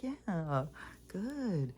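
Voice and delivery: high, sing-song praise; praising the dog. Real, not acted. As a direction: encourage him with a lifted pet voice. Praising the dog after bell.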